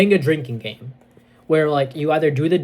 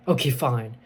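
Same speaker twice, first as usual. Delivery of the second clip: ordinary delivery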